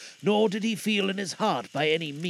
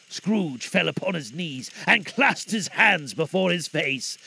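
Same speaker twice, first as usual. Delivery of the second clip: ordinary delivery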